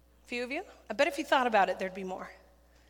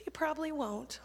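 soft, high aside with a laugh; mock an adult's hushed doubt; 4 semitones higher, 7 dB quieter than usual. Adults dismissing the boy's calling under their breath, doubting aside.

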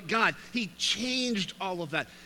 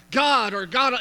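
a bare "God" called out higher and louder; impersonal address; 5 semitones higher, 8 dB louder than usual. Retold or imitated. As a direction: say "God" as a distant, generic call. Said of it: "He's not like".

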